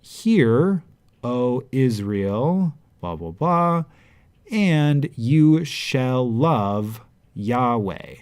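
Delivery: slow, lifted scripture reading; solemn translation. Shown as a demonstration. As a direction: proclaim it like a verse from scripture. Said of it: "translation read out".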